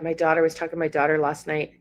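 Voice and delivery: ordinary talk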